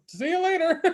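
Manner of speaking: high, sing-song see-ya; mock cheery goodbye. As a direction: toss it off in a high chirpy voice. Friends' casual goodbye, mocked.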